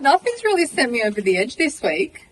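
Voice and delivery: laughing through the words, breaking into a high shrieking laugh; can barely finish the line for laughing; real laughter spilling over into a squeal. Real, not acted.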